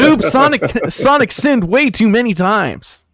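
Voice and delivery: very high, loud cartoon-hero impression; Sonic. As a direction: squeal it as a cartoon mascot, stretching the ending. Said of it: "That's my Sonic impression".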